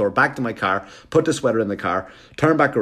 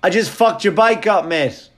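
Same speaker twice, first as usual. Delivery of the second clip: high, loud gloating jeer; gloating taunt; 9 semitones higher, 5 dB louder than usual. Retold or imitated. Comic taunts the man through the car window, loud and gloating. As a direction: rub it in with a cocky jeer.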